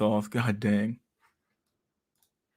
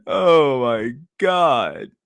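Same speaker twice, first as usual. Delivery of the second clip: very slow, louder, stretched exclamation; drawn-out amazed oh my god; 4 semitones higher, 6 dB louder, much slower than usual. Real, not acted. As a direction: stretch it out in disbelief. After shriek.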